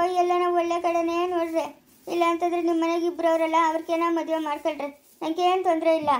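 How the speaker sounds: ordinary talk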